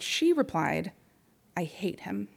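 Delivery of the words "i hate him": softer, real lump in throat; choked up. Real, not acted. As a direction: hold back tears on the words. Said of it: "Getting a little choked up".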